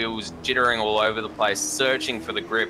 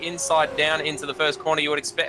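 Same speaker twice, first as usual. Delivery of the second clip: motorsport commentator; 6 semitones higher than usual. Real, not acted. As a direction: lively race call, a bit higher. Live play-by-play.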